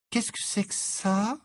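lightly voiced curious girl; curious question. Hermione holding strange object.

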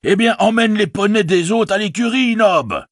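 high, sustained call to a servant; calling out orders. Acted. Innkeeper shouts orders to servant. (ordre lancé d'une voix aiguë)

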